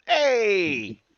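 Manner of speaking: loud, high mobster yell; Penguin yelling. Retold or imitated. Penguin yelling at Batman in a high mobster shout. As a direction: bark it like an angry gangster penguin. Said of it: "he's like".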